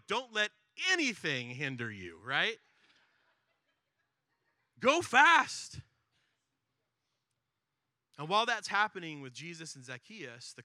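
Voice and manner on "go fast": much higher, loud shout; shouted command. Real, not acted. Preacher shouting the command to the congregation, high and loud. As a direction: blast it out as the climax.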